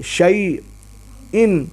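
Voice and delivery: شیئن stretched very slowly with the ay glide; very slow shayin. Shown as a demonstration. Teacher repeats the word slowly for clarity. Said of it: "زبر کی آواز سے".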